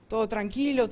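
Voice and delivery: ordinary talk, nothing marked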